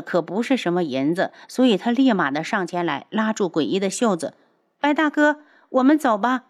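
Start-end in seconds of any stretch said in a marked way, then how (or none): 4.8-6.4 put-on higher, louder sulky girlish voice; petulant young girl. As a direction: whine like a spoiled young girl. Liu'er's voice.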